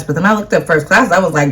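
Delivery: ordinary delivery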